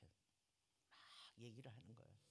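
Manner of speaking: ordinary talk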